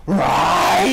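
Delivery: hammed-up villain exclamation; mock villain. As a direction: bark it like the villain losing it. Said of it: "He's like".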